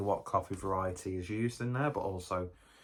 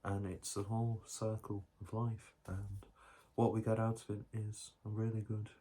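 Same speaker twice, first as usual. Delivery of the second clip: hushed, unhurried documentary narrator voice, softer; mock nature documentary; 4 dB quieter than usual. Vlogger spoofs a nature documentary for viewers, hushed narration. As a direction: spin nonsense like a wildlife presenter.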